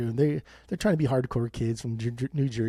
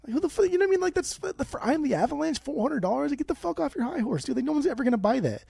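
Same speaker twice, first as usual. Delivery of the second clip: high-pitched indignant rant; exasperated rant; 12 semitones higher than usual. Real, not acted. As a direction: vent your outrage at the greedy seller. Ranting about a 400-dollar price.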